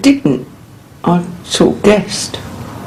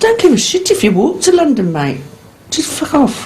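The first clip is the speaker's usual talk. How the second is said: retold angry dismissal; 8 semitones higher than usual. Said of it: "I said".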